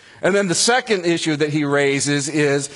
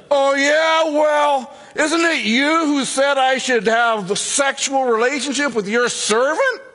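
indignant retort; 11 semitones higher than usual. Pretend. Abraham and Sarah bickering.

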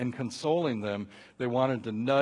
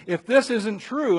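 ordinary delivery

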